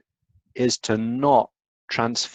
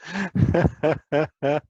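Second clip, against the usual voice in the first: higher chuckling laugh at own joke; real amused laugh; 6 semitones higher than usual. Real, not acted. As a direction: chuckle in a quick run of ha-has.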